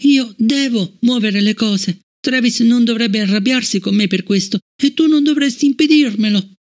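put-on a girl bursting out in rebellion; rebellious outburst. Fallon rebelling against Bliss, defiant high child voice. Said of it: "con una nota di ribellione nella voce Fallon sbottò".

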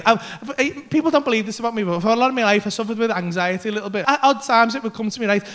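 ordinary talk

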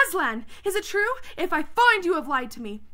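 high, loud threatening demand; queen's alarmed cry. A narrator's reading. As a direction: raise pitch and volume in outrage. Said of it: "cried the queen".

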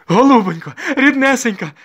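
high, loud lamenting cry; wailing plea. A narrator's reading. Soldier's wife falling at the young lady's feet, wailing. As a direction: wail out a desperate plea. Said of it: "зголосила солдатка Дакія".

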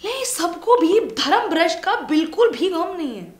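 loud, high mocking outcry; mock outrage. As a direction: exclaim it in exaggerated indignation. Mocking outcry over lost religion.

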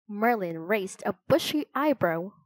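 ordinary delivery, nothing marked

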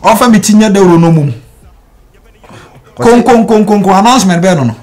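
very high, loud outburst; loud, outraged mocking cry; indignant shouting. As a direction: shout it like a gong announcement. Mocks a gong announcement in quarrel.

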